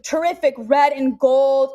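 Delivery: ordinary talk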